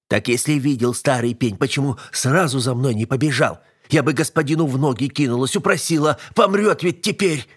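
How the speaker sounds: worked-up scolding that ends high; scolding servant woman. Acted. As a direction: berate the old helper in growing alarm. Voices servant scolding old helper.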